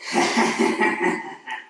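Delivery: slow, raspy villain cackle; menacing genie chuckle, acted; evil Wishmaster laugh. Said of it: "my best Wishmaster impression".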